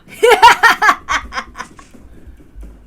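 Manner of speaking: loud, triumphant cackle; wild laugh. Acted. Fighter laughs at the attackers, loud cackle.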